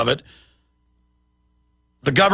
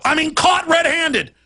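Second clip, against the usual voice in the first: furious shouting; 9 semitones higher than usual. Real, not acted. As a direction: shout out the outrage for real. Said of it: "why I'm so upset right now".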